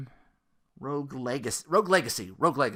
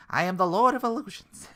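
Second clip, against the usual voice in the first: higher and louder grand villain proclamation; hammy villain; 7 semitones higher, 5 dB louder than usual. Pretend. Voicing the cartoon trickster from the trailer, hammy villain boast.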